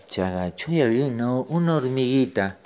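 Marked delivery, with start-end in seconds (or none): none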